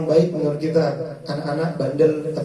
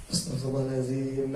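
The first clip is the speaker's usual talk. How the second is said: much softer, gentle, lower; soft calm istighfar; 3 semitones lower, 8 dB quieter than usual. Shown as a demonstration. Preacher showing calm istighfar toward family, soft and gentle. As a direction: murmur it calmly. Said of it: "Gitu kan enak".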